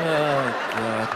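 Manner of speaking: normal talking voice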